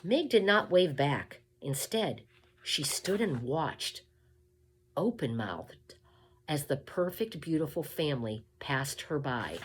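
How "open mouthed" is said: plain reading voice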